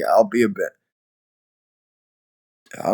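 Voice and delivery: normal talking voice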